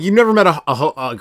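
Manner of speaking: ordinary delivery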